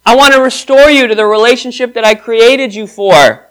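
high, loud pleading voice of God. Acted. Preacher voices God begging the lost, loud and high. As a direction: beg them to come home. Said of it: "So God says, it's like God is begging you".

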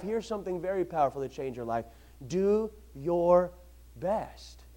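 hushed, near-whispered emphasis. Acted. Preacher to congregation, hushed emphasis. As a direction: drop to a hush to land the point.

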